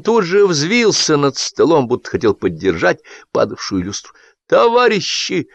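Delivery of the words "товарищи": high, slow call for attention; shout. A narrator's reading. Excited groom calling the guests to attention. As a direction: rise up and call the table to order. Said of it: "закричал он".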